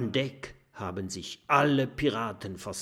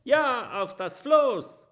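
cheering yell; 14 semitones higher, 6 dB louder than usual. Acted. Pirates agreeing loudly.

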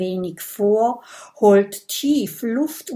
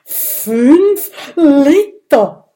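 loud, high, drawn-out question; stunned disbelief; 6 semitones higher, 11 dB louder, much slower than usual. Acted. Dragon hearing how much blood is needed, stunned. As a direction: stretch each word in sheer disbelief. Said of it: "antwortet Big Bo fassungslos".

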